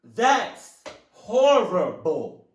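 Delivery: loud, high, very drawn-out words; horrified slow exclamation; emotional outburst. Real, not acted. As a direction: stretch out each word in horror. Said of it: "I'm getting a little emotional".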